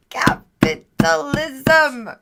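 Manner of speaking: slow, punched, theatrical; drawn-out mock proclamation. Streamer joking about selling wings, drawn-out mock proclamation. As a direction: stretch it out syllable by syllable. Repeats word in contrasting mock delivery.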